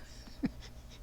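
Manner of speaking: very soft breathy chuckle; brief quiet laugh mid-sentence; small laugh. Real, not acted. Amused aside about where she lives.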